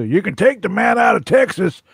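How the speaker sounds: hammed-up Texas accent. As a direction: put on a Texan twang for the saying. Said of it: "I've heard that about Texas".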